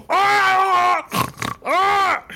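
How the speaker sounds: very high goblin yell; rally the horde with a cry; goblin king yell. Acted. Goblin king yelling at his people. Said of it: "He's yelling at his people".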